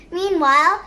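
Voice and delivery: ordinary delivery, nothing marked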